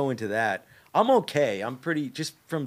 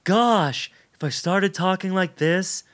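high, louder young pop-singer impression; 7 semitones higher, 7 dB louder, slower than usual. Shown as a demonstration. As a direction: push the voice up and out, light and feminine-leaning. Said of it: "[name]. Do you know [name]?".

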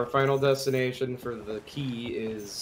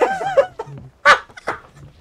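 loud squealing laugh, much higher; high shrieking laugh; 10 dB louder than usual. Real, not acted. Burst of laughter.

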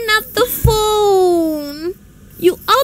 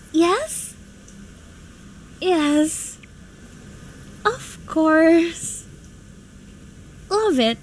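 reluctant agreement; 6 dB quieter than usual. Acted.

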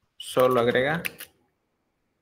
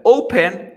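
"open" with a high, loud O; stress on first syllable; 6 semitones higher, 9 dB louder than usual. Shown as a demonstration. Teacher showing students a choice, "open" stressed up front. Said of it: "La fuerza de voz está al inicio".

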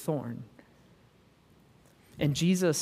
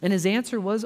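usual speaking voice

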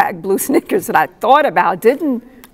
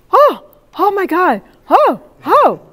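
high, louder panicky oh my God; imitating a distressed woman; 10 semitones higher, 6 dB louder than usual. Fellow traveler across the aisle panicking, imitated high and louder. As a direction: mimic her rising alarm. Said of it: "and she's going".

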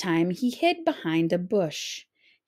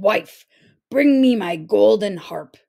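giant command; 7 dB louder than usual. A narrator's reading. Giant orders his wife.

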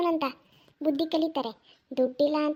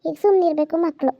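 louder, firm telling-off; loud scolding; 6 dB louder than usual. Acted. Grandmother telling off her grandson over money, loud and firm. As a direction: scold the kid and shut him up.

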